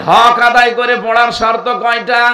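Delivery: loud, high rallying question; preaching shout. Real, not acted. Preacher quizzing the gathering, loud and high. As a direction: call it out to the crowd.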